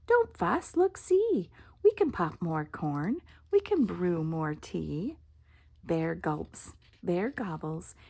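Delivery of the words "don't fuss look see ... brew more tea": squeaky mouse. Acted. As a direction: chirp it up to comfort bear. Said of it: "Mouse squeaks".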